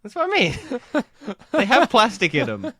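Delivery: high, loud, laughing insistence; laughing protest. Real, not acted. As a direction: protest through real laughter, pitch shooting up.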